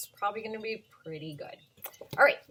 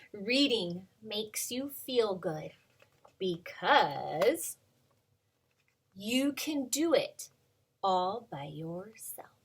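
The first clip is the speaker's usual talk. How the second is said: slow, bright storybook read-aloud; reading to children; slower than usual. Acted. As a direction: read it like a picture book to little kids. Reading picture book aloud.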